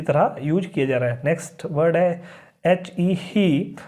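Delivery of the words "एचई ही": ही with a long ee; teacher sounding out ही with a long ee; spelling then saying he. Shown as a demonstration. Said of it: "बड़ी ई की मात्रा".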